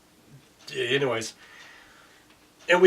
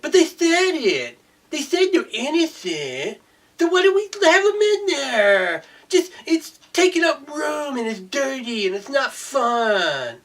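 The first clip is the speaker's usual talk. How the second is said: much higher, slow whiny mock voice; mocking whiny prisoners; 11 semitones higher, 4 dB louder, slower than usual. Ranting man mocks prisoners who claim innocence, high and whiny. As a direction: whine it like a pouting inmate.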